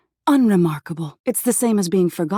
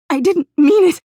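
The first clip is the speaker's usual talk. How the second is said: much higher and louder, a broken wail; desperate scream; 12 semitones higher, 7 dB louder than usual. A narrator's reading. Crumbling body begs the doppelganger, wailing in terror. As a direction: scream it out in despair as you crumble.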